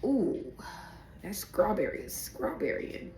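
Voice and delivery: breathy savoring. Real, not acted. Savoring the aroma.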